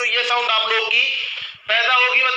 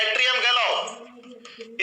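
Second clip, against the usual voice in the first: slow drawn-out term; 7 dB quieter, much slower than usual. Shown as a demonstration. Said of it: "इसे क्या बोलते हैं?".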